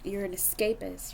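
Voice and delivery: ordinary delivery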